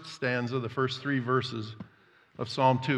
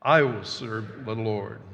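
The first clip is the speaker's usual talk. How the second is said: ordinary talk, nothing marked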